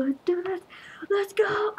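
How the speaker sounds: high-pitched battle cry; excited hype. Real, not acted. Player charging into the fight, excited hype. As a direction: psych yourself up, pitch way up.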